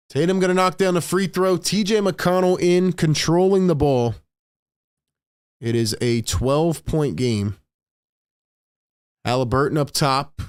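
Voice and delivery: quick, lively game call over the action; live play-by-play. Acted. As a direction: call each play as it happens.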